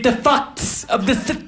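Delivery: "fucks" runs into out cut to a bare t; "South" squeezed to s'th, no O or U; vowels dropped. Acted. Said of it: "They take out the O and the U".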